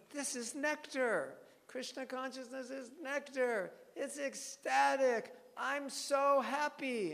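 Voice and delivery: high, slow, gushing delight; ecstatic preaching. Retold or imitated. Telling people Krishna consciousness is nectar, ecstatic. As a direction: preach it as if the joy overflows. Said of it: "'cause we're gonna be telling them".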